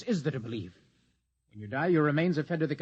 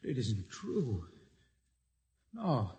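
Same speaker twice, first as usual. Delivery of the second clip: stunned denial; 3 semitones lower, 5 dB quieter, much slower than usual. Acted. Shocked denial at control room.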